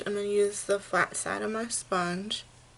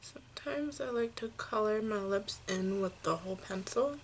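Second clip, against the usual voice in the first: whispering. Real, not acted. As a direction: whisper it like a little secret. Confiding aside about lip pencil.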